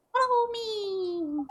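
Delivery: very slow, high and stretched; ghost girl calling. Acted. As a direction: draw it out high like a spirit beckoning.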